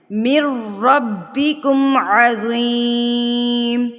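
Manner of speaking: slow chanted tajweed recitation; Quran recitation. Acted. Teacher recites the verse in slow tajweed chant. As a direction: recite it slow and melodic, drawing out vowels.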